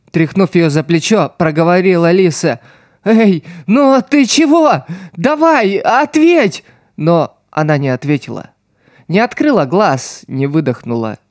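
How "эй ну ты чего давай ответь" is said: very high, slow, desperate; frantic pleading. A narrator's reading. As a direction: shake her and beg her to answer. Shaking lifeless friend.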